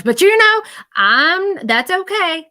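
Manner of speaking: imitating a spirit laughing it off, high and loud; high mock shrug. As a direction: jump way up in a playful, joking voice. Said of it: "he's like".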